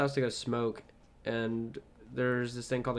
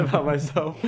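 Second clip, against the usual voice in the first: laughing; 8 semitones higher, 4 dB louder than usual. Real, not acted. Laughs at oversharing.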